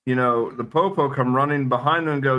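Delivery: usual speaking voice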